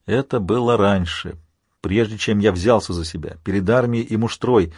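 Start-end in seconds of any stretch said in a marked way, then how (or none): none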